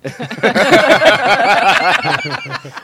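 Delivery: high, fast words through laughter; laughing. Real, not acted. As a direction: laugh it off while protesting. Laughing off a friend's insult.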